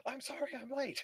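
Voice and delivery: high, breathless late arrival; flustered apology. Pretend. Late student bursting into class.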